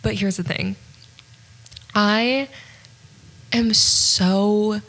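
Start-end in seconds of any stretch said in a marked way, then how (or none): 1.9-4.8 drawn-out, exasperated emphasis; emphatically mad. Real, not acted. Podcaster venting about a movie split in two parts, emphatically mad. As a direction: stretch each "so" in frustration. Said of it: "so mad that it's in two parts".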